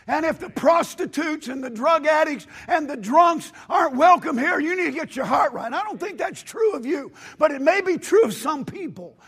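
loud, high, shouting preacher; full-force preaching. Acted. Pastor rebuking a church that shuts out the poor, shouting. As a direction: thunder it at the congregation.

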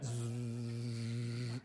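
low buzzing hum of a bee. Shown as a demonstration. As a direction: make the bee buzz. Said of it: "just that buzz".

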